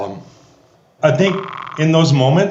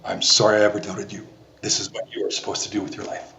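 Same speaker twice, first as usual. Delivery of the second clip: quiet tearful father; 4 semitones lower, 7 dB quieter than usual. Retold or imitated. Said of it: "he says".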